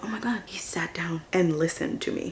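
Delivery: hushed gasp of realization; retold whisper. Recalling the moment dad listened, whispered. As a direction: whisper it in disbelief.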